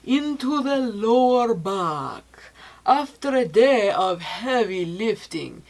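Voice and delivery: slow, measured usage-instructions read; unhurried product-info narration. Acted. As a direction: read the label directions like a calm ad. Reading product usage directions.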